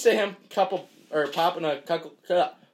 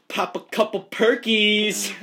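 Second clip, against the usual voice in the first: hyped announcement; 7 semitones higher, 6 dB louder, slower than usual. Acted. Hyping up the tongue twister.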